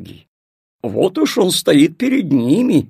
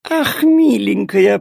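slow purring cat voice; 6 semitones higher, 4 dB louder, slower than usual. Acted. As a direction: purr it out like a fond cat. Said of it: "промурлыкала она". (мурлыкающая кошка)

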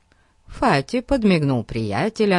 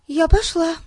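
put-on high, quick young girl; eager girl voice; 13 semitones higher, 4 dB louder than usual. A narrator's reading. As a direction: jump up and announce it. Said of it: "вскочила Хилари".

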